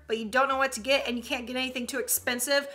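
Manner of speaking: ordinary talk, nothing marked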